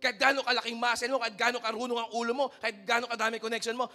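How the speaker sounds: ordinary talk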